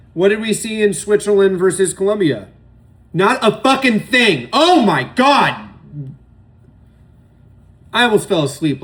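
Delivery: loud, high exasperated outburst; exasperated rant. Real, not acted. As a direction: throws his hands up at a boring game, loud. Ranting at a dull match.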